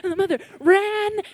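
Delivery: put-on mother racing to her screaming child, urgent; urgent narration. A narrator's reading. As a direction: tell it as if running to the door.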